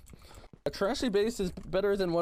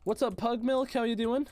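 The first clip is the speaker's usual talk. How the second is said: cheery greeting; 8 semitones higher than usual. Real, not acted. Greeting a viewer in chat.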